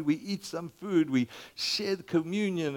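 ordinary talk, nothing marked

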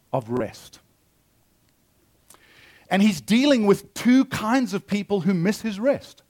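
normal talking voice

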